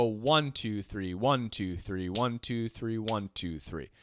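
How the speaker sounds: steady triplet count fading to near silence. Shown as a demonstration. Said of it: "this is going to be triplets".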